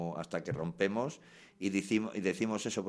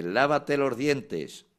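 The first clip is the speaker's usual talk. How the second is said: put-on slow, loud, high command to a child; traditional parent order; 7 semitones higher, 9 dB louder, much slower than usual. Parent ordering a child to brush teeth, slow loud command. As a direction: drag out the order like a fed-up parent. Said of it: "voy a ser tradicional y voy a decirte".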